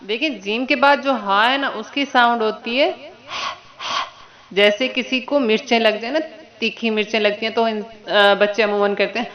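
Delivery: ordinary talk, nothing marked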